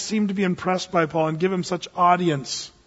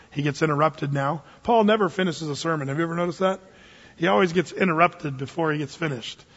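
usual speaking voice

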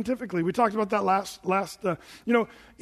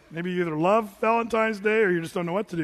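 usual speaking voice

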